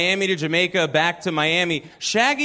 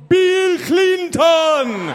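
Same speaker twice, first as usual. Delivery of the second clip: bad Jamaican accent; 10 semitones higher, 5 dB louder, much slower than usual. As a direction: crow the punchline in a hammed-up Jamaican accent. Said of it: "I gotta do a Jamaican accent".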